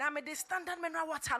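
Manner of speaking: ordinary talk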